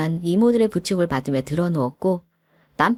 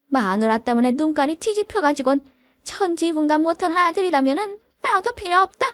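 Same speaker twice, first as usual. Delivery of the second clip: higher, bitter mother-in-law; voiced scornful mother-in-law; 8 semitones higher than usual. Mother-in-law disowns her son, scornful. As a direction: sneer and disown, lightly voiced.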